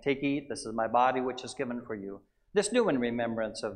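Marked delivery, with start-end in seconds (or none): none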